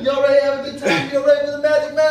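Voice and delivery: high, fast imitated MC shout; stage hype man. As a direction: rev up an imaginary crowd. Reenacts hyping a silent crowd.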